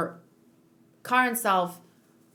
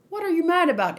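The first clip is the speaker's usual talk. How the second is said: puzzled; 8 semitones higher, 6 dB louder, faster than usual. Acted. Reiner questions Eren.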